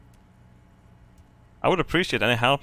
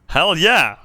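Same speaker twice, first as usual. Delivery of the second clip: loud, higher whoop of pride; excited cheer; 5 semitones higher, 5 dB louder than usual. Real, not acted. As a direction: cheer it like a victory. Cheering his own spin-kick.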